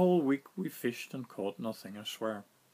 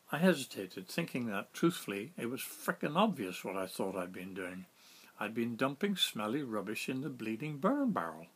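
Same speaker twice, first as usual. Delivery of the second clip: ordinary delivery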